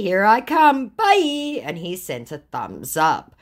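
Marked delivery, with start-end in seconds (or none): 0.0-1.6 high, taunting farewell; re-enacted mock-cheery kiss-off; gleeful sign-off. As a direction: sing out the goodbye to tease. Acts gleeful sign-off to scammer.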